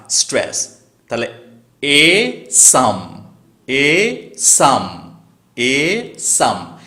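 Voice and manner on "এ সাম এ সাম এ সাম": slow এ then সাম, each stretched out; drawn-out beat. Shown as a demonstration. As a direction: chant এ সাম very slowly as one foot. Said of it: "এইরকম". (টেনে টেনে এ সাম)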